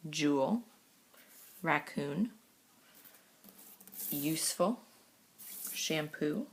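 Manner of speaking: each word read alone, with long pauses between; word list reading. Acted. Teacher reading the spelling list to students, slow and deliberate. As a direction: read the spelling words out one by one for the class.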